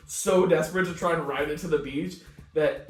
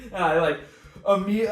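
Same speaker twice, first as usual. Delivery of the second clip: laughing; 4 semitones higher, 7 dB louder, much faster than usual. Real, not acted. Laughing at his own panic.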